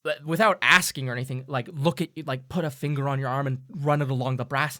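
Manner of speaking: ordinary delivery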